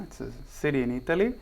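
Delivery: normal talking voice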